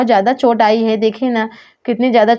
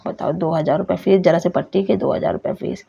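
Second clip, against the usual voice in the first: low, softer muttered grumble; sulky mutter about the fee; grumbling under breath; 4 semitones lower, 5 dB quieter than usual. Acted. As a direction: grumble it to yourself so the doctor barely hears. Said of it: "अरे आप क्या बड़बड़ा रहे हैं?".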